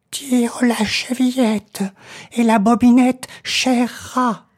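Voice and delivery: high, slow granny voice; old grandmother. Acted. Grandmother tells the visitor to open the door, high. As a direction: call out gently like an old granny.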